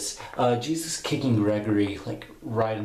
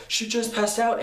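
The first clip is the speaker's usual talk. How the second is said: high, mock-defensive voice; indignant protest; 12 semitones higher than usual. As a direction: plead innocence, pitched way up. Acted protest of accused character.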